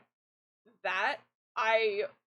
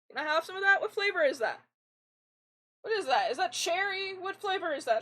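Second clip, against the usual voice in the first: ordinary talk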